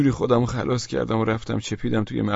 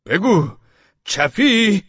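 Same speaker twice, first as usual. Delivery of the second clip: higher, louder demand; interrogating shout; 9 semitones higher, 7 dB louder, much slower than usual. Acted. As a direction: bark the question with each blow. Policeman interrogating while beating. (بازجویی با فریاد بلند پلیس)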